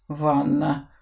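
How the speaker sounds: low, soft mumble to himself; mumbled under breath. Acted. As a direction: mutter the word into your chest. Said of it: "пробубнил себе под нос".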